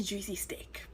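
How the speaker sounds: soft unvoiced aside; whisper. Real, not acted. Teasing viewers about the steak, whispered. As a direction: hush it like a secret.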